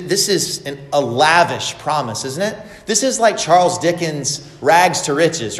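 ordinary delivery

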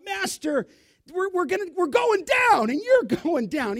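high, loud panicked yell of terrified fishermen; panicked cry. Retold or imitated. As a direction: cry out in terror that the boat is sinking. Panicked disciples wake sleeping Jesus.

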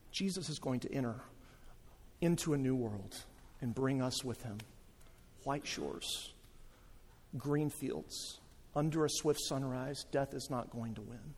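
soft, low sermon voice; gentle, pause-filled assurance to a congregation; hushed preaching. Acted. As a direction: bring it right down, quiet and reverent. Hushed preaching after the story.

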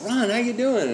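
high-pitched retold greeting; delighted surprise. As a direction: imitate a friend happily caught off guard. Old friend surprised by his call.

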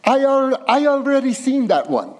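put-on high, loud Arabic-accented grandfather; bad Arabic accent. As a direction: dismiss it in a comic Arabic accent. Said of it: "forgive my bad Arabic accent here".

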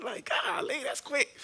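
high, incredulous inner protest; mock disbelief. Husband privately reacting to wife's quick plans, high mock disbelief. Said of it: "I'm thinking in my mind".

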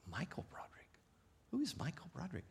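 whispered, baffled thinking to oneself; very soft questioning murmur; puzzled inner thought. Retold or imitated. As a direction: whisper it as a confused child's private thought. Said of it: "I thought".

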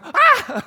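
sharp high startled shriek; startled yelp. Retold or imitated. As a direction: yelp like you just got the fright of your life. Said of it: "I go".

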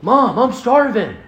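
high, loud mock child's complaint; child's plaintive call, hammed up; complaining small child. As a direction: imitate a hungry kid pestering Mom. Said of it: "those of us who have small children".

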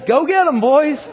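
high, loud battle order; rallying shout. Acted. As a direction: shout it to send your troops charging. General rallies his troops to attack.

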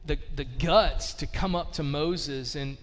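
ordinary talk

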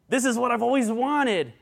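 put-on high, elated child's exclamation; kids on Christmas morning. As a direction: squeal it like a kid unwrapping presents. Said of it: "It's my kids opening up Christmas presents".

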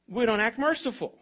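ordinary talk, nothing marked

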